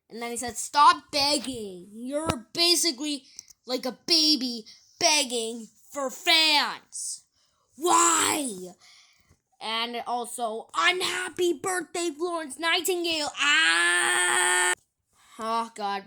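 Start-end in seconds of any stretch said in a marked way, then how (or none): 0.6-8.7 loud, drawn-out telling-off; scolding. Acted. Vlogger reading a comment against begging for fans, loud scolding. 10.8-13.3 high, loud yelled greeting; mock shout. Vlogger reading out an unhappy birthday wish, shouted. 13.4-14.7 long, high, loud scream. Acted. Vlogger ending a shouted birthday message, long scream. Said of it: "Perfectly cut scream again".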